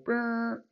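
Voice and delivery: high, held honk noise; imitated truck horn. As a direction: mimic a truck horn blaring. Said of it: "I hear a horn go".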